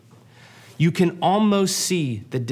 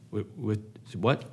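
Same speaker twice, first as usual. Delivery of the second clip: stunned disbelief; 6 semitones lower, 9 dB quieter than usual. Acted. Friend reacting in disbelief.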